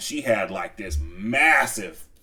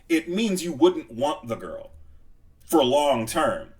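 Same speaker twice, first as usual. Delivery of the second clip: usual speaking voice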